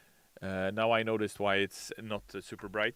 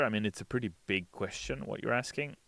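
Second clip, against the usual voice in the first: ordinary talk, nothing marked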